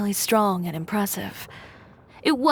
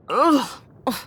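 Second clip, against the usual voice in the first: loud disgusted groan, pitched up; disgusted ugh; 4 semitones higher, 3 dB louder than usual. Acted. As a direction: recoil from a bad taste with a loud ugh. Tasting a flavor she dislikes.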